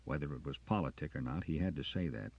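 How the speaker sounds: ordinary delivery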